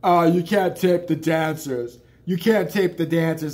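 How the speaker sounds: imitating the big bouncer telling him off; mock bouncer. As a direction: lay down the rule like a heavy security guy.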